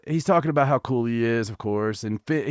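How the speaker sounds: usual speaking voice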